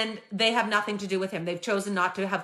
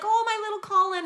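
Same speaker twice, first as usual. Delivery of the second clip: sweet; 11 semitones higher than usual. Retold or imitated. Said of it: "her reaction was sweet".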